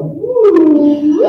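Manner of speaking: a happy cheer of joy; bright little woo of delight. Retold or imitated. Reacting to her own music.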